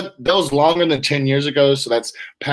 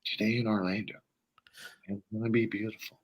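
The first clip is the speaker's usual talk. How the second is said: imitating a hushed YouTube weatherman, much softer; mock soft-spoken forecaster, lower and softer; super quiet; 3 semitones lower, 12 dB quieter, faster than usual. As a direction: say the forecast barely above a breath. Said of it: "like, super quiet".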